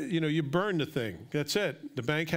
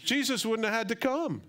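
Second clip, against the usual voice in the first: excited preaching; 5 semitones higher than usual. Real, not acted. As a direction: let the excitement run away with the sermon. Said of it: "Sorry, I get excited".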